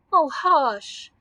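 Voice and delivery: high, loud mock sob; sobbed. A narrator's reading. Giant begging Dorothy to hush, mildly sobbing. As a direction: plead in a lightly tearful wail. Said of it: "sobbed the giant".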